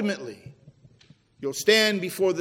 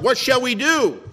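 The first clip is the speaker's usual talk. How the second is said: a raised, higher cry from the crowd; 6 semitones higher, 6 dB louder than usual. Acted. The crowd cries out to the preacher, asking what to do. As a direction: plead it out loud like a desperate crowd. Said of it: "that cries out".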